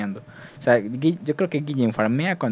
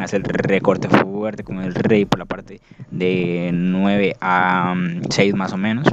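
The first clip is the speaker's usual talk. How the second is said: play-by-play commentary; 2 semitones lower than usual. Acted. Commentating a Clash of Clans attack for viewers, in play-by-play style. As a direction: call the attack like a caster.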